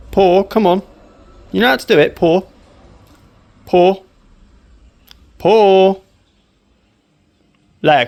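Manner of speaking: loud firm dog commands. Real, not acted. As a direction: call out each command firmly.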